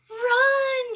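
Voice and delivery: high, loud cry of alarm; frightened high shout; cried out. A narrator's reading. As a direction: yell it in panic. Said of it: "she cried to Ankylosaurus".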